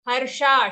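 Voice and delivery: हर्षा ending long and sustained; long sha. Shown as a demonstration. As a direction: hold the final vowel of हर्षा. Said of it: "sha long".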